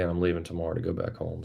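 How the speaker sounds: ordinary talk, nothing marked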